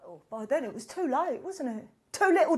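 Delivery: ordinary talk, nothing marked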